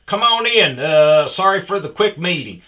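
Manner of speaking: loud, high mock boss voicemail; voicemail rant. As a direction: blast it like a pumped-up host. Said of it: "my voicemail goes like this".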